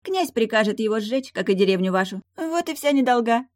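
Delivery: high, loud, matter-of-fact mermaid; careless mermaid. Acted. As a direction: deliver the threat lightly, pitched high. Mermaid carelessly predicts burning.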